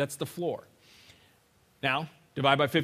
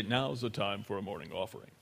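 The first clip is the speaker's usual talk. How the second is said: offering announcer; 8 semitones lower, 10 dB quieter than usual. Retold or imitated. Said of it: "somebody stands up front and they say".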